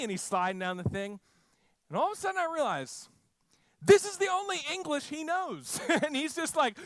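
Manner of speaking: ordinary talk, nothing marked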